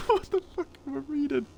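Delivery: high, squeaky laughing voice; laughing disbelief. Real, not acted. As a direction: crack up while asking it. Cracks up reading absurd chat.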